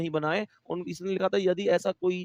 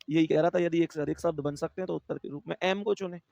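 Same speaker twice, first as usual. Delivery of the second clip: ordinary talk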